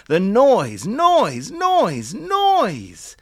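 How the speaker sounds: rising pitch each repeat. A narrator's reading. Each noise rising higher.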